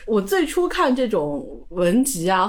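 ordinary talk

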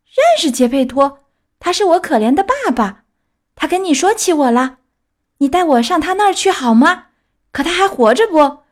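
put-on high, loud pleading boy asking after his father; high, loud puppet voice, eager and anxious. A narrator's reading. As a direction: beg to be taken to papa, voice up high. Pinocchio's voice.

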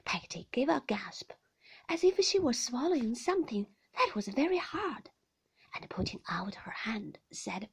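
ordinary delivery, nothing marked

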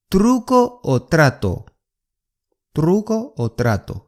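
Truco o trato with rolled r, slowly; 慢速西语示范; slow Spanish demo. Shown as a demonstration. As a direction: sound out Truco o trato clearly. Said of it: "有点难发音啊".

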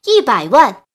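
loud, punchy auction bid; calling a bid. A narrator's reading. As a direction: call it out across the room.

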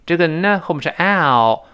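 ordinary talk

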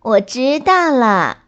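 little rabbit brushing mum off; mildly dismissive child's reply; perfunctory. A narrator's reading. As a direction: answer just to get mum off your back. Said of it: "小兔敷衍地跟妈妈说".

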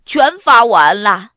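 loud, pleased announcement. A narrator's reading. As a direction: happily proclaim the job done. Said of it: "亚瑟高兴地说". (高兴地大声宣布)

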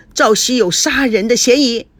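plain reading voice

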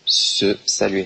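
slow, careful model of the phrase; slow teaching model. Shown as a demonstration. Teacher modelling the phrase slowly for students. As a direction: say it slowly so the class can copy. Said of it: "je vous l'écris ici".

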